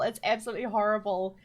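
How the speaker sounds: ordinary delivery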